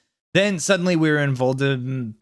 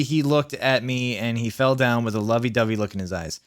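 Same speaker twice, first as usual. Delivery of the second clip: usual speaking voice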